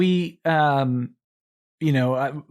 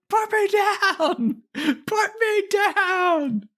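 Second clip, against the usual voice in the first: very high, drawn-out wail; mock screaming; much slower than usual. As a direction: shriek it slowly, stretching the words. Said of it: "I'm screaming".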